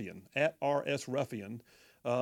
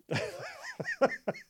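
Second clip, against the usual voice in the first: high wheezing laugh; amused laughter. Real, not acted. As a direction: crack up at the joke. Laughs at a listener's text.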